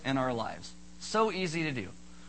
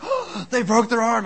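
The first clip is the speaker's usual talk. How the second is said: hammed-up panicky gasp, loud and raised; freaking out; 5 semitones higher, 8 dB louder than usual. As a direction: imitate a shocked bystander panicking. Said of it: "someone's freaking out".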